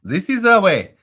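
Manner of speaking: mock German accent, loud and high. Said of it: "the whole show in a German accent?".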